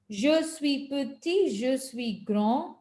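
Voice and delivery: "Je suis petit, je suis grand" said slowly and distinctly; slow, clear model of "Je suis"; careful model pronunciation. Shown as a demonstration. Teacher models pronunciation for the class. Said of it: "get your pronunciation right".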